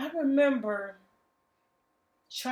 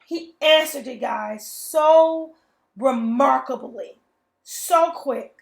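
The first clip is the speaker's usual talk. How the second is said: louder, bursting with amazement; excited testimony; 2 semitones higher, 6 dB louder, slower than usual. Real, not acted. Telling her viewers how God answered, loud and amazed. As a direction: let the joy spill out in praise.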